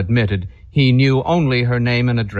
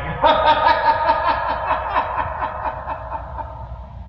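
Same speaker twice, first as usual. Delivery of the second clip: high, breathy laugh from far off; a faint, eerie laugh; distant laugh; 4 dB quieter than usual. Acted. Ghostly singer laughs outside.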